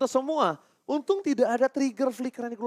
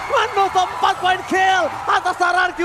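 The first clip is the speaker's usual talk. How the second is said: fighting game announcer; 7 semitones higher, 3 dB louder than usual. Real, not acted. Live play-by-play continues.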